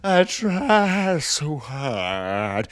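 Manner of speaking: Peter Griffin voice, slow and drawn out. Retold or imitated. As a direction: stretch it out like the cartoon dad. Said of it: "why did I say that like Peter Griffin?".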